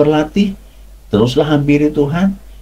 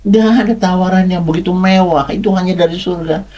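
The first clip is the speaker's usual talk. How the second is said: normal talking voice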